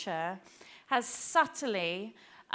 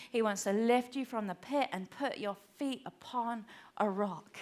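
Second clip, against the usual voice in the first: ordinary delivery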